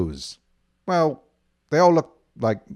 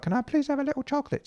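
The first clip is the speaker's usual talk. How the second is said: high, fast little cat plea; begging cat; 12 semitones higher, 4 dB quieter, much faster than usual. Pretend. Host voices a cat begging for chocolate, high and fast. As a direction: beg sweetly like a cat wanting a treat.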